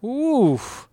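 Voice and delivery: high, drawn-out oof; wincing oof. Retold or imitated. Reacting to [name]'s diss, a high pained oof. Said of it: "I was like".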